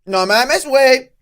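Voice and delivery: exaggerated shouted Mexican Spanish; loud, hammy Mexican hype; Mexican accent. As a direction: yell it high with an over-the-top Mexican accent. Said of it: "How do you do a Mexican accent?".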